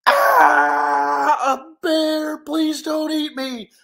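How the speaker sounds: high, wailing cartoon terror; scream, then plead for your life; panicked scream. Hero facing a giant bear, panicked pleading.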